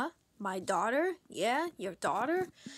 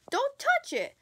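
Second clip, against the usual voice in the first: much higher, louder snap; sharp warning; 11 semitones higher, 6 dB louder than usual. Acted. Host warning the kids not to touch the tree, loud. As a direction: warn them off sharply.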